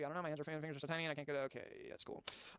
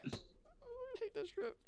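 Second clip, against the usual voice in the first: slow, high, laugh-strangled groan; laughing disgust; 5 dB quieter, much slower than usual. Real, not acted. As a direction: laugh it out in dismay. Laughing disgust while reading.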